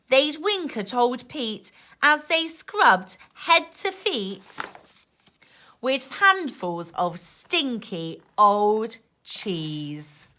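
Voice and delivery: put-on lively rhyming read-aloud for kids; reading to children. A narrator's reading. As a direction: bounce the rhymes like a bedtime book. Reading rhyming picture book aloud.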